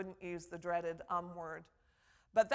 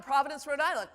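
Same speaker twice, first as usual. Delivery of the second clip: "Providence, Rhode Island" louder and higher, falling to a full stop; right vocal emphasis; 4 semitones higher, 7 dB louder, faster than usual. Shown as a demonstration. Professor showing the fixed answer to where from, firm emphasis. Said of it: "Gives it the right vocal emphasis".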